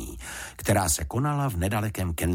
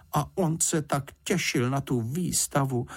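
higher, pitying mother; sympathetic regret; 8 semitones higher than usual. Acted. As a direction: feel sorry for the poor bear. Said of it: "litovala Paddingtona paní Fousková". (lítostivě, vyšším hlasem)

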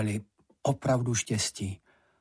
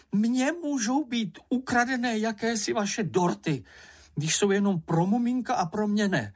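high-pitched, aggrieved little character; indignant outburst, more pitch than volume; shouted tirade; 9 semitones higher than usual. A narrator's reading. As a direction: protest in a squeaky, offended voice. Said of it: "zakřičel".

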